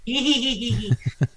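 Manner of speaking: put-on cackling old hag laugh; witch laugh. Shown as a demonstration. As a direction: cackle like a witch, not quite there. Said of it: "that was my witch laugh".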